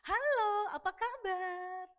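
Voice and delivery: high, slow, loud sing-song greeting; exaggeratedly friendly, lilting hello; softened, drawn-out greeting. Shown as a demonstration. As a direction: stretch each greeting out sweetly. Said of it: "kayak dilembeng-lembengin gitu ya".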